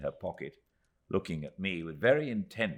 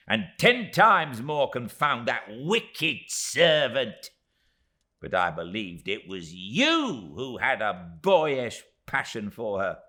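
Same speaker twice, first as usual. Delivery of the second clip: high, loud and slow curse; damn him with all her tiny might; fierce little curse; 7 semitones higher, 8 dB louder, slower than usual. Acted. Miss Moucher cursing Steerforth, high and loud.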